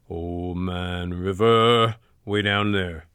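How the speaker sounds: very low, slow and rumbling; lowest note. Shown as a demonstration. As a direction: sink to the bottom of your range. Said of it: "Go to the lowest note".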